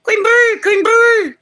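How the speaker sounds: high, loud honking bird call; stately honking crane. Pretend. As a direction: honk it out like a crane. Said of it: "did that sound like a whooping crane".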